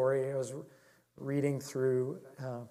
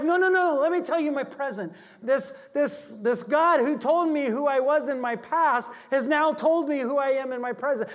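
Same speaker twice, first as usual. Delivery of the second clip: high, loud pleading "no, no, no"; insistent; 9 semitones higher, 5 dB louder than usual. Retold or imitated. Ironside insisting the woman hear his present, loudly. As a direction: press on, raised and eager.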